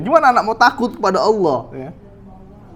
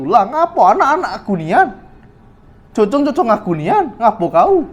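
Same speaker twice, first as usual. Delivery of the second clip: defiant comeback with swooping, raised pitch; stubborn retort; 3 semitones higher than usual. Acted. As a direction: play a parent who rejects all advice. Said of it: "ini udah keras hatinya".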